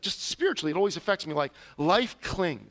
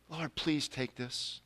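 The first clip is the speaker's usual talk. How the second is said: much softer, slow, lower plea; hushed prayer; 5 semitones lower, 10 dB quieter, slower than usual. Retold or imitated. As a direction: murmur it as a humble prayer. Retold humble prayer.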